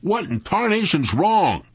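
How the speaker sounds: high, exasperated call; yelled out. A narrator's reading. Sam struggling with the mules, yelling out to Jeff. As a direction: holler it with contained force. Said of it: "yelled out".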